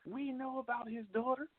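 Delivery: high, sustained pitch for the listeners' reply; imagined audience. Acted. Host acting out what listeners probably say, high-pitched imagined audience. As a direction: voice the know-it-all public, pitched well up. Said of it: "I know you probably say".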